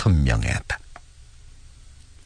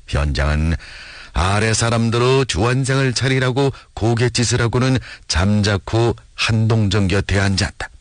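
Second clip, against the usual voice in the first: ordinary delivery, nothing marked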